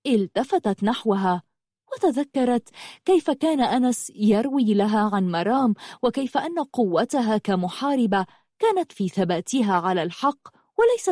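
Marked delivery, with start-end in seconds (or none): none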